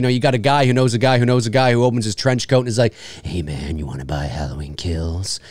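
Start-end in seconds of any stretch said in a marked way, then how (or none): 3.2-5.4 low, whispered sales pitch; shady dealer. Acted. Trench-coat guy offering a bootleg movie, furtive whisper.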